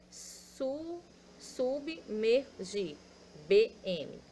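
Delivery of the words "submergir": submergir slowed to su-bi-mer-gir; slow syllable-split submergir. Shown as a demonstration. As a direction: stretch submergir out, sounding each syllable.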